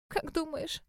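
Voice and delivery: pitiful, pleading question; plaintive. A narrator's reading. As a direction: ask in a small pitiful voice. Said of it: "жалобно спросила она".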